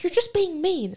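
high-pitched mimicked complaint; mocking accusation. Imitating everyone who calls her mean, high and mocking.

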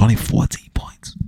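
hushed whisper like a forbidden name. Real, not acted. Telling viewers Mahomes' shameful score, whispered. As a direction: whisper it so nobody hears. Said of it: "I gotta whisper that".

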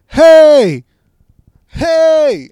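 put-on high, very loud drunken holler; drunk yelling. Drunk woman calling to people right beside her, yelling. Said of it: "You don't need to yell".

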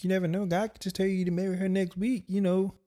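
high-pitched caricature of a female friend; mock friend's voice. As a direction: put on an exaggeratedly high voice to quote her. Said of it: "Yeah, she don't sound like that".

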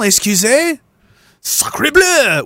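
high hammed-up French exclamations; french chef. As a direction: play an outraged French chef. Mock French outrage at definition.

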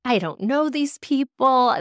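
imitating your own nervous freak-out; retold freak-out. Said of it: "I was kind of freaking out".

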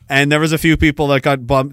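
ordinary delivery

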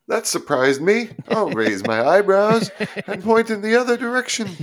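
high, hammy surprised voice; mock actor overacting. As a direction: overplay the surprise. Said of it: "eyebrows are raised and lowered".

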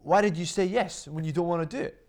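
acted-out frank telling-off; exasperated friend. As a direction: imitate a blunt friend urging you. Friend acted out, scolding her.

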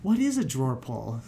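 imitating Log Lady. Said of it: "David Lynch does this".

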